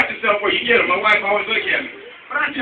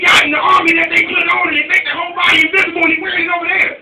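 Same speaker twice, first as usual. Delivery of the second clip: put-on higher, eager little boy; kid explaining; 9 semitones higher, 5 dB louder than usual. Boy explaining the army suit, eager kid voice. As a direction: rattle it off like a know-it-all kid.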